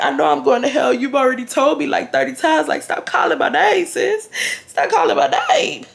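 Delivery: pleading that climbs to a high squeal; imitating growing fear. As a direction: build from exasperated plea to mock-panicked high pitch. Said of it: "I'm getting more and more scared".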